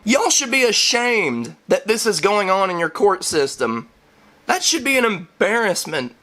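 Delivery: high-pitched, hammed-up indignation; amazed disbelief. Viewer telling off the clapping jury, appalled amazement. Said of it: "sitting there in amazement".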